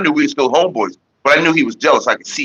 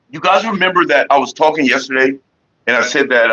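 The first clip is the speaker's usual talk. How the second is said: normal talking voice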